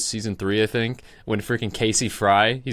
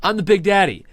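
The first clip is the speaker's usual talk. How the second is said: higher, louder mock line; quoted line; 8 semitones higher, 5 dB louder than usual. Friends quoting the line to say, louder mock. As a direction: toss off the catchphrase. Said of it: "your hand and say".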